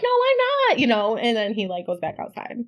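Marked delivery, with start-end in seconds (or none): 0.0-0.8 high denial. Retold or imitated. Wife denying to her husband, high. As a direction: deny it flatly. She denies.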